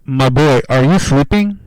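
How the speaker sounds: loud wake-up call to a friend. Real, not acted. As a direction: holler to rouse a silent co-host. Calling out to silent co-host.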